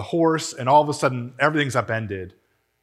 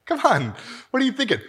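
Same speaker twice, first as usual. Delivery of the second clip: high-pitched imitated protest; mock incredulous; 12 semitones higher, faster than usual. Preacher voicing doubters in his church, mock incredulous. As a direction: object in exaggerated disbelief, pitch jumping up.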